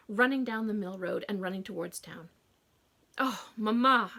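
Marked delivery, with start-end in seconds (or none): 3.2-4.1 exasperated. A narrator's reading. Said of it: "I spat, exasperated".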